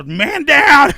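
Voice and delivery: high, booming mock alarm; imitated yell. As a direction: holler it like a toppled drunk. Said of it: "like, yelling at me".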